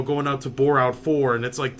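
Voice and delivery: usual speaking voice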